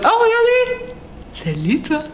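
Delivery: high, slow cooing to an animal; cooing at a pet. Real, not acted. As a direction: coo over him like a cute pet. Talking to a cute animal. (voix attendrie très aiguë et lente)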